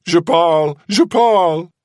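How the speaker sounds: high, loud desperate French plea; panicked begging. Acted. Seaman begging Kazala to stop, panicked. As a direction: beg frantically to be spared.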